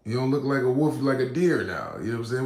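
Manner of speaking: normal talking voice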